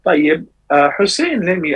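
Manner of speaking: normal talking voice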